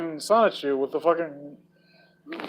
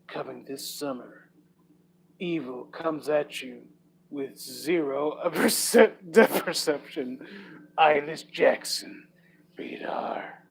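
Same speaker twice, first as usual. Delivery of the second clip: movie trailer voice; 5 dB quieter, slower than usual. Pretend. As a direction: tease it like a horror film trailer. Mock movie trailer read.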